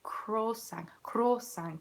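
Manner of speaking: "croissant" as kro-SANG; Swedish pronunciation. Shown as a demonstration. Showing how Sweden says it, "croissant" as kro-SANG. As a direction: say "croissant" Swedish style, ending in ng. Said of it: "In Sweden, it's".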